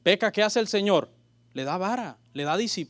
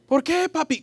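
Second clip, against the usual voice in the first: put-on high pleading little child; 9 semitones higher, 4 dB louder than usual. The son asks his father why, high and childlike. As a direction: ask like a hurt little kid.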